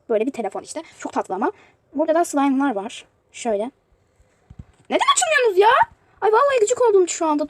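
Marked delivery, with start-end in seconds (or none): 4.9-5.7 high, loud, exasperated cry at the screen; frustrated yell. Real, not acted. Toy box won't open. 6.2-7.5 higher and louder, fed-up; annoyed outburst. Real, not acted. Annoyed at stuck game.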